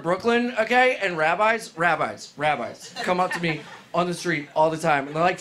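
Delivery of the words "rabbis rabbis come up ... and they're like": normal talking voice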